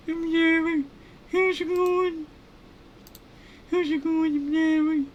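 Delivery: loud, squealing crazy scientist; very high-pitched, over-the-top character squeal; Larry. Acted. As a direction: introduce yourself in an absurdly high cartoon voice. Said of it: "I did a crazy voice for that guy".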